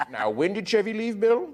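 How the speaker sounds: high, soft questioning voice; mock quizmaster. Storyteller quizzing his tired listener, high mock voice. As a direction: lift it into a quizzing imitation. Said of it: "this is gonna be a quiz".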